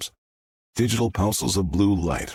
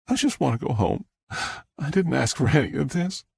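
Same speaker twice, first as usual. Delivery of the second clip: plaintive, higher-pitched plea; tearful; 6 semitones higher than usual. A narrator's reading. Star tells Jack she wants home, tearful. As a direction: protest through tears. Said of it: "He wiped warm tears from her cheeks".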